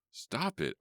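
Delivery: contained, high-pitched scream. A narrator's reading. Worker overwhelmed by animal noise, restrained scream. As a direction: cry out high without much volume. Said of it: "I screamed".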